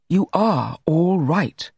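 "are" stressed, slowly insisting; slow, insistent stress on "are"; insistent repeat. A narrator's reading. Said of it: "he repeated".

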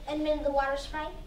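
plain reading voice

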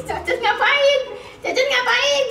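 high, loud teasing pet-talk; playful teasing. Real, not acted. Mami teases Cucon about loving Dede, playfully.

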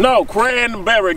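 Cranberry said slowly and firmly, each syllable clear; slow correction of Cranberry; correcting the name. Real, not acted. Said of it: "Get the name right".

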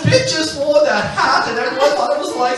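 a high, giggly British duchess gushing to Oprah; Fergie in a posh English accent. Retold or imitated. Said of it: "in the English accent".

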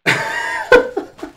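high-pitched relieved laughter. Real, not acted. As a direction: laugh it out after a scare. Laughs after a near death.

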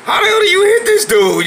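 high, loud baffled yelp. Retold or imitated. Retelling his frustration at the dodging trainer, high, loud, baffled. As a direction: cry out in mock disbelief. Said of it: "I was like".

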